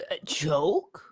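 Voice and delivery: incredulous whisper, slow; whispered disbelief. Acted. Cohost whispering back the word joke in disbelief. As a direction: breathe it out in mock shock.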